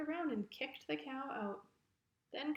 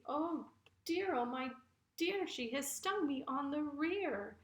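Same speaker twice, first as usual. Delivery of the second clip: high-pitched, theatrical cry of dismay; hammed-up ouch of distress; pained lament; 4 semitones higher than usual. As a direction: wail like a stung ram in a children's story. Stung ram jumps and laments.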